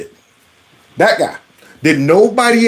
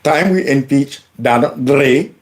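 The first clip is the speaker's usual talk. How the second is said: lightly mocking Indian accent, ending raised; mimicked Indian accent; 3 semitones lower than usual. Host parroting congressman's impeachment line, mock Indian accent. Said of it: "understand the heavy accent".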